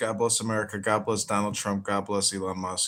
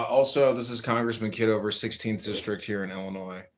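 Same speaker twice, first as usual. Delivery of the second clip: usual speaking voice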